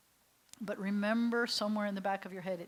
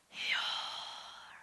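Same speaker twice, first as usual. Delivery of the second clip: breathy command; 7 dB quieter than usual. Shown as a demonstration. God's command, dramatic hush.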